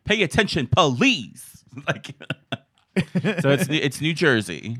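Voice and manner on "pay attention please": high, loud scolding quote; mock tirade. Friend quoting the table-flip fight, mock tirade. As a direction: yell it like a reality-show outburst.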